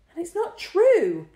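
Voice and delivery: high, loud, slow indignant protest; high, loud, deliberate outrage; angry insistence. A narrator's reading. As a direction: insist angrily, banging the table. Lloyd banging the table.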